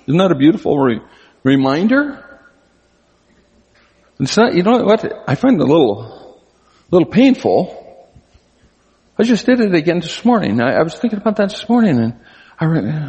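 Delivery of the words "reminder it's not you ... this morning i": ordinary talk, nothing marked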